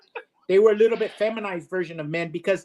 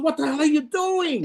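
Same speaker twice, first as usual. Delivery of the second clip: high-pitched mock outrage; aghast Russian; 9 semitones higher than usual. Joke's Russian seeing the Mexican shot, high and aghast. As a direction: shoot the pitch up in disbelief. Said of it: "the Russian goes".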